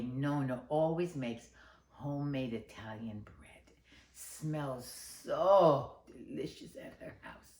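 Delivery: hushed storyteller whisper. Acted. Storyteller tells the children about Nonna's bread in a whisper. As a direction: lean in and whisper it like a secret.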